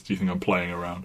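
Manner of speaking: ordinary delivery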